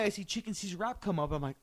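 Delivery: usual speaking voice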